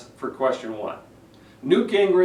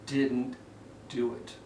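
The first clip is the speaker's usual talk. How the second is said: much softer, falling and calm; soft and slow; 3 semitones lower, 11 dB quieter, slower than usual. Shown as a demonstration. Coach showing the quiet ending, soft and low. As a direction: let the words drop down quietly. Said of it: "Notice how my last two words".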